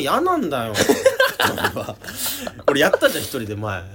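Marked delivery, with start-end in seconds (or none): 0.8-2.6 loud high falling laugh. Real, not acted. Refusing to record alone, loud laugh.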